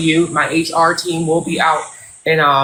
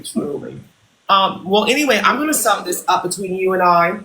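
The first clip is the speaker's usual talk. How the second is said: ordinary talk, nothing marked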